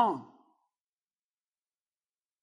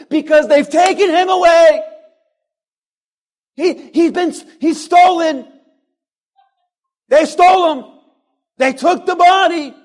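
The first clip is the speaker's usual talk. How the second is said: louder and higher, mock distraught; frantic mock panic; 4 semitones higher, 3 dB louder than usual. Pastor voicing frantic Mary at the empty tomb. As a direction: blurt it like a panicked mourner. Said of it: "notice what she says".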